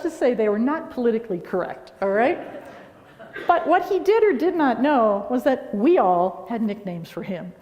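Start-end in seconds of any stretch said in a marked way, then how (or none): 3.5-7.1 laughing. Real, not acted. Laughing confession.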